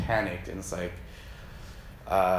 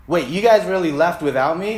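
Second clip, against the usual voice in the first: loud, raised mock disbelief; hammed-up indignant kid; putting it on them; 5 semitones higher, 7 dB louder than usual. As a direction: accuse them of leaving, playfully acted. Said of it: "Putting it on them".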